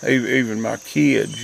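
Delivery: ordinary talk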